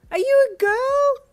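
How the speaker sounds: very high, loud, slow screech; a traveller stunned by the face under the mask. A narrator's reading. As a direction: screech it in total shock. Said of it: "you screeched".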